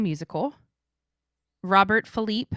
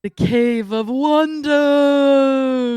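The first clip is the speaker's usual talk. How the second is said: slow booming loud movie voice; Cave of Wonders voice; 7 semitones higher, 10 dB louder, much slower than usual. Pretend. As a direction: boom it out slow and grand. Giving the answer dramatically.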